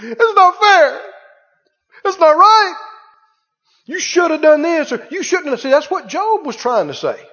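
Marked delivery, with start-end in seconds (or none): 0.0-2.8 loud, high mock outcry; angry protest at God. Preacher imitating people shaking a fist at God, angry outcry. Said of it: "they shake a fist and go". 3.8-5.6 high-pitched imitated accusation; mock angry protest. Preacher imitating people blaming God, high indignant protest. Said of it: "they shake a fist and go".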